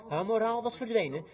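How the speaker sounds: usual speaking voice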